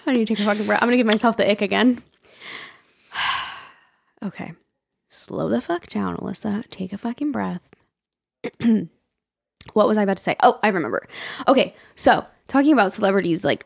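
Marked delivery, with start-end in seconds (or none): 5.1-7.7 soft, breathy whisper to oneself; hushed self-talk. Real, not acted. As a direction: talk yourself down under your breath. Talking herself down, out of breath.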